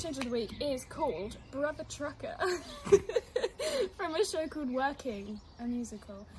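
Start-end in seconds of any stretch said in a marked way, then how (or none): 2.4-3.9 loud high laugh; burst of laughter. Real, not acted. Reacting to the song title.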